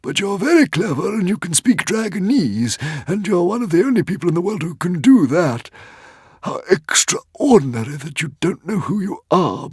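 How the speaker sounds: high, fretful little dragon voice; an anxious old dragon piping up; Woden's Fang voice. A narrator's reading. Old dragon to Hiccup, high and fretful.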